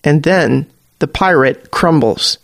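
plain reading voice

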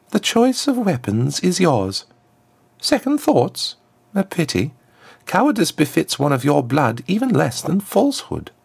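very high, polished diplomatic goading; high-set aristocratic challenge; smooth ambassador, taunting. A narrator's reading. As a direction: needle her with formal courtesy.

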